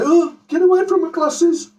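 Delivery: high, loud, panicky protest; mock fussy granddad. Grandad mocking a fussy granddad who guards his glasses. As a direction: shriek it like a precious old man guarding his specs. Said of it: "what you cannot do is say".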